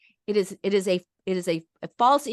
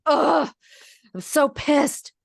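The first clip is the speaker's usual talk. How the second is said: exasperated groan into a slow, louder outburst; expressing anger; 6 semitones higher, 4 dB louder, slower than usual. Shown as a demonstration. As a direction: vent it, really pissed off. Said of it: "Expressing anger is like".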